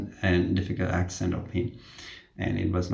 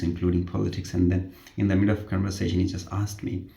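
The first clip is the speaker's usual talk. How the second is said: usual speaking voice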